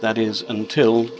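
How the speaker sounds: usual speaking voice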